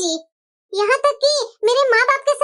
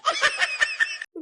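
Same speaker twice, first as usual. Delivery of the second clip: high mocking laugh; 8 dB quieter than usual.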